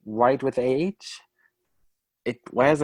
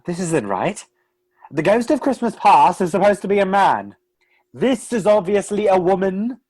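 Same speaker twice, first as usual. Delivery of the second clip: loud, fast, higher objection; indignant protest; 5 semitones higher, 5 dB louder than usual. Real, not acted. Narrator stops the story to object to Marley, loudly. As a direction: stop the show, this is wrong.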